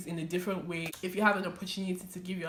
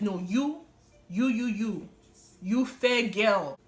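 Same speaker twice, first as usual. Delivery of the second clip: slow, louder preacher's call, a hint of the pastor; 4 semitones higher, 7 dB louder, slower than usual. Pastor singling out the girl in church, slow and emphatic. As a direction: point her out from the pulpit.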